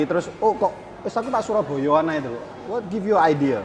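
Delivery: ordinary talk, nothing marked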